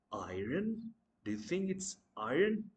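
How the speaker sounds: iron with the R sounded, "eye-run"; mispronounced iron. Shown as a demonstration. Teacher quizzing learners, iron wrongly with sounded R. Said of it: "That's not iron".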